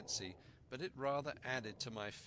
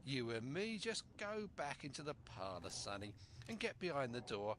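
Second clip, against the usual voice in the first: purring pirate with a rising grin; sly pirate. Acted. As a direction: coax the boy like a crafty buccaneer.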